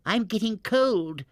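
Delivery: high, whiny complaint; complaining. A narrator's reading. Barnaby's mother grumbling in the balloon, high and whiny. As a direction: moan about the chill. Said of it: "complained Barnaby's mother".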